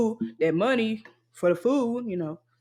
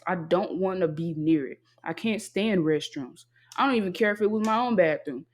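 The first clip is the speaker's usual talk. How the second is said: ordinary talk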